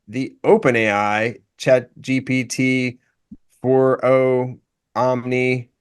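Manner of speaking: slow, stiff robotic delivery; monotone machine speech. Shown as a demonstration. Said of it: "I'm talking like a robot".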